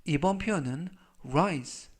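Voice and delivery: normal talking voice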